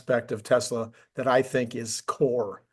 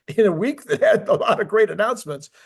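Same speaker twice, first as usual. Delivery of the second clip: amused, higher-pitched aside through laughter; laughing; 7 semitones higher, 4 dB louder than usual. Real, not acted. Host qualifying last week's biggest battery announcement, laughing.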